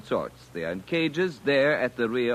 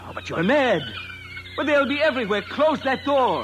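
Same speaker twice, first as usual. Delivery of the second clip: shrieking for the door to be shut; frantic alarm; 12 semitones higher than usual. Acted. Alien scientist begging the engineers to close the door, high and frantic. Alien panics at loose vermin.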